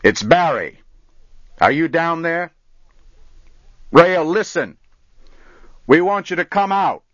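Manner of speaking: loud, high call down into the dark. Acted. Barry calling down to the missing boy, loud and high. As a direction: shout down the canyon to him.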